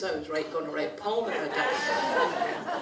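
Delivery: slow, chuckling self-mockery; amused, laughing aside. Real, not acted. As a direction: laugh at yourself while saying it.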